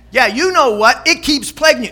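loud, high, insistent needling; nagging devil. Acted. Devil reminding us of failures, loudly nagging. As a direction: hammer it at the listener. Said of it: "and the devil says".

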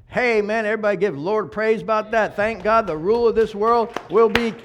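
loud, lifted call to praise; rally the room with mounting volume; rousing preaching. Real, not acted. Preacher urging everybody to give the Lord praise, rousingly.

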